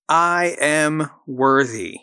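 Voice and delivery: slow, deliberate, every word weighted; slow affirmation. Shown as a demonstration. Host leading listeners in an affirmation, slow and deliberate. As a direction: lay it down slowly so they can repeat it. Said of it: "So say that with me".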